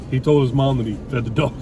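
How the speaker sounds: usual speaking voice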